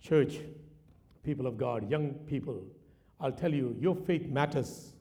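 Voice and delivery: low, slow, weighty appeal; solemn exhortation. Real, not acted. Preacher calling church and young people to witness, solemn exhortation. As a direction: press it home gently and deliberately.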